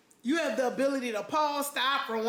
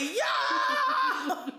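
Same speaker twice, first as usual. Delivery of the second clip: a high, laughing yell; shocked yo shout; 11 semitones higher than usual. Retold or imitated. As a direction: blurt out pure shock.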